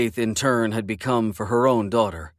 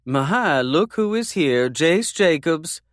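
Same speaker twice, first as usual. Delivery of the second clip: put-on older woman; 6 semitones higher, slower than usual. A narrator's reading. Mother voiced in story.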